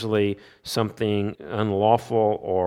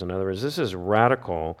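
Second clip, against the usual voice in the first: ordinary talk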